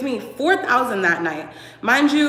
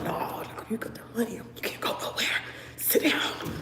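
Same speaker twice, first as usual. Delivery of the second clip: whisper; 11 dB quieter than usual. Retold or imitated. Stopping client from leaving.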